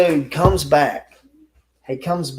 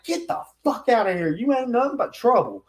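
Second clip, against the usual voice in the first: higher, heated telling-off; angry dismissal; 9 semitones higher than usual. Retold or imitated. The narrator telling the couple to get out. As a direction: throw them out.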